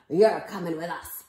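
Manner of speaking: loud pirate captain's order; hammed-up commanding sea dog; Braidbeard. A narrator's reading. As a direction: order the boy along, pirate style. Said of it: "Braidbeard told me".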